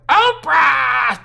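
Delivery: high, loud screeched answer; loud shouted echo of the call; screeching back. A narrator's reading. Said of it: "Justin screeches back".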